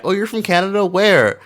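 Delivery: high-pitched, mock-interested questioning; imitated curious questioner. Stranger asking a traveler about Canada, imitated curiosity.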